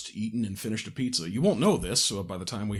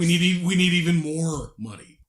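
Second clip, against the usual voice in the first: louder hammed-up plea for more money; mock desperate plea; 7 semitones higher, 10 dB louder than usual. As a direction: ham up the cry for money. Joking about needing money.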